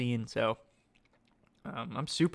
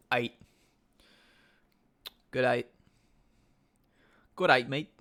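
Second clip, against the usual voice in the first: put-on a light Australian accent on the slang. Streamer jokes to chat in a light Australian accent. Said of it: "That's my Australian accent".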